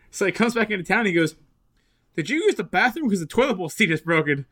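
usual speaking voice

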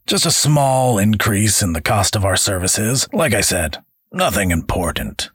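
put-on high, oily drunken welcome; a boozy boss hosting guests; sly, tipsy mercenary chief; Elihu, tipsy and smug. Elihu voiced character.

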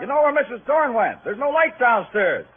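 shouting up at a window; calling up. Acted. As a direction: hail someone upstairs, loud and high.

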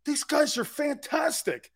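high, slow, drawn-out praise; retold gushing. Grandfather marvelling at his grandson's guests, high gushing.

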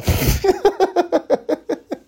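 high, bubbling run of giggles; long giggling laugh. Real, not acted. Podcaster cracks up at his California jab, laughing. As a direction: burst out giggling at your own joke.

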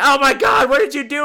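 high, loud mock outrage; imitating strangers' shocked cry; shocked bystanders. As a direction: gasp it like a horrified crowd. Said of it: "Everybody's like".

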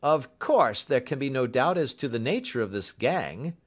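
a hint of a confident policeman; inspector character voice. A narrator's reading. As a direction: state it as official certainty. Voices Bradstreet.

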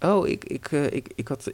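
hesitant, stammering grandmother; flustered excuse. Acted. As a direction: stall while making up a lie. Caught grandmother invents an excuse. (betrapt stamelen)

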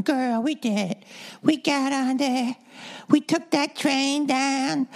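mock gushing marcher; imitating excited women. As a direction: squeal it like celebrating friends. Quoting women hugging after march.

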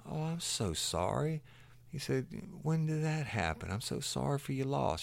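high, soft mock sympathy; sympathetic man. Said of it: "And he said".

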